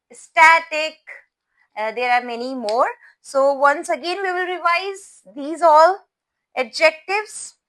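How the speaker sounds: usual speaking voice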